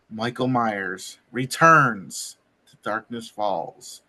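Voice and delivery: low, slow horror-trailer voice; dramatic announcer. Acted. Host recapping the show as a slow, low trailer line. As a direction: intone it like a trailer.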